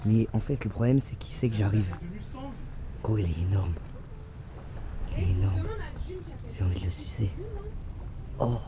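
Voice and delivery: low, hushed voice. Real, not acted. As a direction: speak quietly so it won't flee. Quiet approach to lizard.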